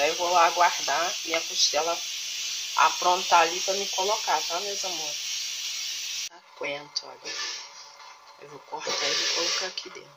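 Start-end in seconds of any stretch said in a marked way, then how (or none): none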